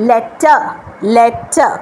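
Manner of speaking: লেটার said with no r sound at the end; correct pronunciation. Shown as a demonstration. Teacher models লেটার for the class, r dropped. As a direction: model লেটার cleanly, r silent. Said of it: "এটার pronunciation কি?".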